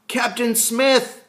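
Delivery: high, loud, drawn-out shout of joy; boy spotting the captain, crying out with joy; joyful cry. Acted. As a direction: call out in delighted relief. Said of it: "I cry".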